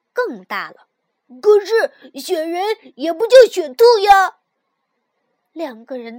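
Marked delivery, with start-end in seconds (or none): 1.4-4.4 much louder and higher, indignant; even louder. A narrator's reading. The frog shouts back even louder, indignant retort. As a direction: top his shout with your own. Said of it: "跳跳的嗓门儿呢，更大了".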